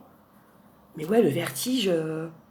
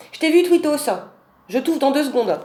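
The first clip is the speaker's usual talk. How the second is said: higher cooing to a pet; high pet-talk; 5 semitones higher, 3 dB louder than usual. Real, not acted. Promising her cat outside to open soon, in high pet-talk. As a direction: coax the cat sweetly.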